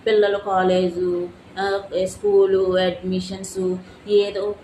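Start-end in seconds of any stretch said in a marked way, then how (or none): 0.1-2.8 louder and slow, each item hammered; spelled-out emphasis. Real, not acted. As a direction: drive home each word. Listing grievances angrily.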